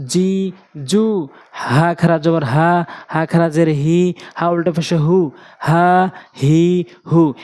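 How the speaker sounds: long held vowels in যা, জি, জু; slow recitation drill holding যা and হা; one-alif elongation. Shown as a demonstration. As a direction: stretch হা, হি, হু evenly after each spelling. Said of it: "এক আলিফ করে টেনে পড়ব".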